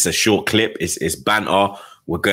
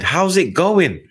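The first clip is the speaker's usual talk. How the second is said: trolling wife; 10 semitones higher, slower than usual. Retold or imitated. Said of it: "come in to try and troll".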